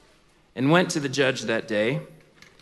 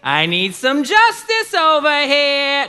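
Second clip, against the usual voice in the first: loud, high, drawn-out holler; widow hollering for justice; 10 semitones higher, 6 dB louder, much slower than usual. Acted. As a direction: call out brashly, stretching the last word. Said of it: "That's how she would say it".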